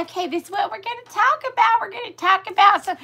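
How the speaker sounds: high, put-on granny voice; practice grandma voice. Practicing for the live in the shower, high granny voice. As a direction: pitch it up into a silly little practice voice. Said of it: "I start practicing".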